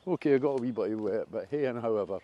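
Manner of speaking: usual speaking voice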